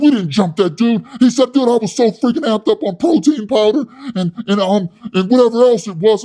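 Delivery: high, loud, puffed-up yelling; loud hothead Wes. Retold or imitated. Wes scolding his soy boy cellmate, loud and high. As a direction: rant like a tough guy with something to prove. Wes denying the gym fight.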